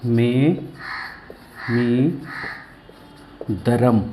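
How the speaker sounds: मे, मी, धरम as three separate pieces with long pauses; broken into pieces. Shown as a demonstration. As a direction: dictate मे मी धरम piece by piece, slowly. शब्द को टुकड़ों में सिखाना. (मे, मी, धरम अलग-अलग, रुक-रुककर)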